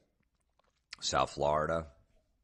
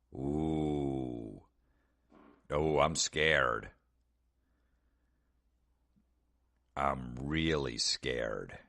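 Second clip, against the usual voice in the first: mock fear; 2 semitones lower, much slower than usual. Sarcastic reply to a threat.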